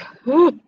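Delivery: high, loud gasp; sharp rising intake of breath. Real, not acted. Mock shock at the joke.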